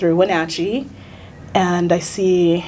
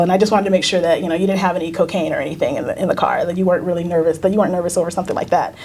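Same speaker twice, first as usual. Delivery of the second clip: rushed officer; much faster than usual. Retold or imitated. Officer explains suspicion.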